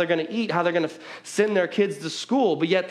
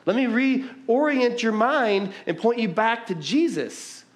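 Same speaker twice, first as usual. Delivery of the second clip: higher, slow, earnest voice of David; voicing David; 6 semitones higher, slower than usual. David speaking to himself in a higher, earnest voice. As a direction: say it like David turning his own heart around. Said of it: "and he's like".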